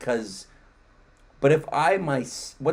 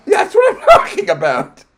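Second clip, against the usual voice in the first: much higher and louder, laughing; exasperated mock shout; 13 dB louder than usual. Voicing the guest's exasperation in a laughing mock shout. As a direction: play the guest blowing up, half laughing.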